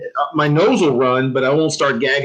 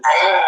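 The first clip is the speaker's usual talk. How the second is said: short, higher crying yelp; mock pained cry; 4 semitones higher than usual. Teller imitating the drill sergeant hit by gas, in a short crying yelp. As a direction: yelp like someone hugged by a gassed private. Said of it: "and then she, you know".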